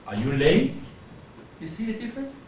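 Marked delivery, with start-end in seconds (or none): 0.1-0.8 "late" swept upward; rising question. Shown as a demonstration. Teacher demonstrating while standing up, rising question. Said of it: "movement for creating a question".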